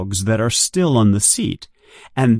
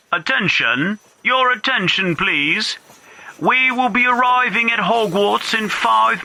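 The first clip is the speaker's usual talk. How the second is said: much higher, announcer voice over a train speaker; loudspeaker announcement; 9 semitones higher than usual. Acted. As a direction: call out to the whole train.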